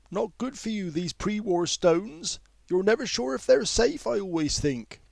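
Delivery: put-on high, light complaining older woman's voice. A narrator's reading. Older woman grumbling to no one in particular, high and fussy. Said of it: "as though complaining about the punctuality".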